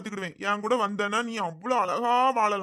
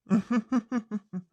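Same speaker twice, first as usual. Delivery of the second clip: short gloating laugh to himself; villainous chuckle. Acted. Groom alone after fooling her mother, a sly gloating chuckle. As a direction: savour the win with a sly laugh.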